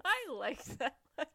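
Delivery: high, loud mocking echo through laughter; teasing mimicry. Co-host teasing her friend for liking the letter, laughing mimicry.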